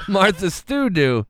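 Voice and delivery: slow, laughing repeat of the odd phrase. Real, not acted. Host repeating the silly robot line to co-hosts, laughing. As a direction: say it through real laughter, savouring the nonsense.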